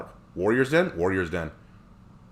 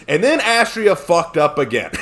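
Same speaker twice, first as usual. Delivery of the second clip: loud, slow, higher, breaking into laughter; laughing; 9 semitones higher, 5 dB louder than usual. Real, not acted. Joking about a t-shirt slogan, laughing loudly. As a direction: stretch out the punchline through a laugh.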